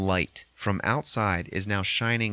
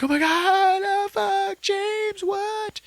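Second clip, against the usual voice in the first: very high, loud panicked cry; horrified; 4 dB louder, much slower than usual. Retold or imitated. Lisa realizing James was taken, very high horrified cry. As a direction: scream it in horror. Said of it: "And she's like".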